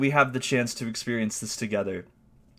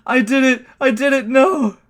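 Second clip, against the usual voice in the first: high, loud disbelieving groan of blame; loud self-reproach; 8 semitones higher, 7 dB louder than usual. Real, not acted. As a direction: berate yourself out loud. Blaming himself loudly.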